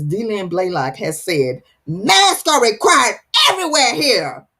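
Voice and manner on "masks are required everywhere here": higher, louder yelled readout; yelled all caps. Retold or imitated. Host reading a viewer's all-caps comment, mock yell. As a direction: shout it like it's typed in all caps. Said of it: "She yelled it".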